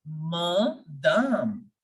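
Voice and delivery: madame broken slowly into ma-dahm; French madame, drawn out as ma, dahm; French pronunciation. Shown as a demonstration. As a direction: say madame in two slow syllables, long open second a. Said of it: "We will pronounce this word as".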